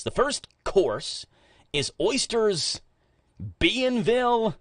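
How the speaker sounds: put-on joking Danville accent. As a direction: tackle the fancy French name in a put-on Danville accent. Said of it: "That's my Danville accent coming out".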